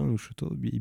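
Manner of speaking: ordinary talk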